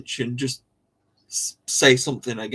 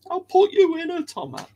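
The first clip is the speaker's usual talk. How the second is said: very high, playful threat; silly high-pitched warning; mock threat. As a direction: scold them in a squeaky high voice. Mock threat quoted to chat.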